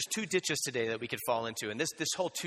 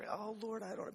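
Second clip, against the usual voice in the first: much higher, softer mock-helpless sigh to God; mock fretting prayer; 10 semitones higher, 6 dB quieter than usual. Preacher mimicking himself stuck on the sermon, high and soft mock lament. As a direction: play the stuck preacher pleading for help.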